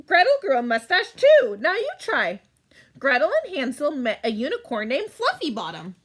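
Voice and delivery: usual speaking voice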